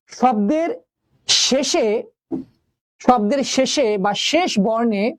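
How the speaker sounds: slow, deliberate rule statement; slow emphasis. Shown as a demonstration. Teacher slowing down to drive home the rule. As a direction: spell out the rule slowly. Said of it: "stressing the rule".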